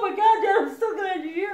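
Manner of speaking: high, loud relief, laughing and near tears; relieved cry. Retold or imitated. Daughter greeting dad at the door, relieved tearful cry.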